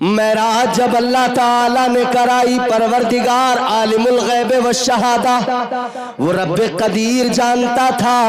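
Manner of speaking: loud, ringing sing-song sermon delivery; full-force preaching. Real, not acted. Preacher calling congregation to praise the Prophet, chanted exhortation. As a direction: proclaim it and drive the crowd to respond.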